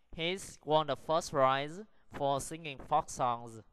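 slow reading broken into short phrases; careful, measured pace for learners; slow model sentence. Shown as a demonstration. Teacher models sentence five for practice, slow and careful. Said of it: "Practice the sentences".

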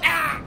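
high, loud cry; pained yell. Pretend. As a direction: yelp as if bitten. Attacked by dog in game. (grito agudo de dolor)

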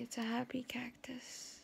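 soft unvoiced whisper. Real, not acted. Vlogger whispers about her cactus key holder. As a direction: whisper it playfully. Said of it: "whispered aside".

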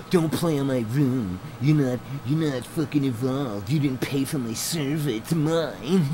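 slightly high sneering mock; a hint of a spoiled brat; mocking petty owner.

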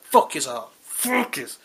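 loud, high burst of cursing; mock angry rant. Caller acting out his ticket rant, mock furious. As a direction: curse them out in a mock rage.

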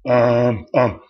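drawn-out ums held on a level note; mock hesitation, stretched out; imitated filler ums. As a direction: act out an old umming habit. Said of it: "So I stopped saying, um".

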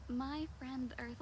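ordinary talk, nothing marked

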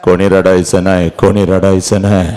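low, heavy command; holding back grief; subdued father. Acted. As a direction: forbid tears with the voice sunk down. Father's order, voice drops low.

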